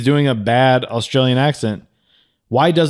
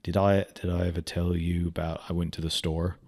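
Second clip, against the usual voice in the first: low, very soft, rambling mumble; Mel Gibson impression; 5 semitones lower, 11 dB quieter than usual. Host mocking the actor's unremarkable delivery, low quiet impression. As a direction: imitate an actor droning under his breath. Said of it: "He's just kind of like".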